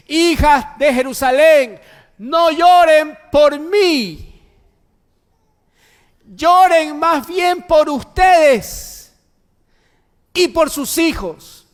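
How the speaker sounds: high, slow preacher's cry, stretching each phrase; loud proclamation. Acted. Jesus to the weeping women of Jerusalem, loud proclamation. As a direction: proclaim it at full force, very loud and drawn out.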